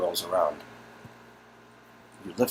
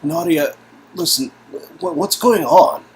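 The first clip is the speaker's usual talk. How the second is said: louder, higher, pressing; urgent; 8 semitones higher, 11 dB louder than usual. Acted. To cousin Nadia, urgent and louder. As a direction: press her for answers.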